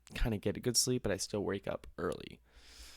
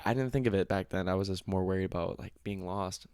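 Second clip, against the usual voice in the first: ordinary talk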